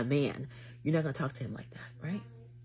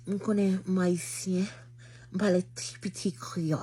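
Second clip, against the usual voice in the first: swanging Haitian Creole; 4 semitones higher than usual. Retold or imitated. Showing off Haitian Creole to her date, with swang. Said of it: "I was putting that little swang to it".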